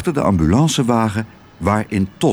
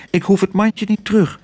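lightly voiced Otje; 8 semitones higher than usual. A narrator's reading.

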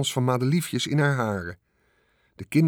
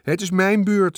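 high, loud claim; insistent; 7 semitones higher, 5 dB louder than usual. A narrator's reading. Sister insisting it is her turn on the swing, loud. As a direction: demand your turn.

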